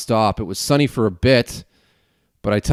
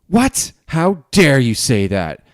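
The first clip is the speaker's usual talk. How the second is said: mock outrage; 5 semitones higher, 5 dB louder, slower than usual. Mock outrage at the town remark.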